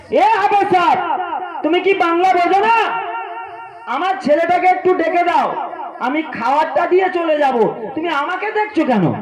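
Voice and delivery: put-on an old mother demanding her son, offended; indignant scolding. Mother rebuking the hafez teacher for staring, high and indignant.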